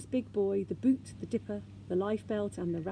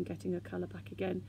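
usual speaking voice

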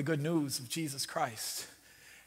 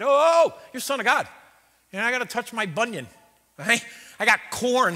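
mock offended Peter; 6 semitones higher, 7 dB louder than usual. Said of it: "Peter's the first one offended".

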